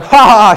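high, loud mocking ha ha ha; hammed-up fake laugh; stupid laugh. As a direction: cackle like a silly wife mimicking. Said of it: "She does a stupid laugh".

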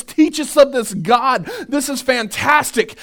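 eager townsfolk. Retold or imitated. Nineveh people voiced.

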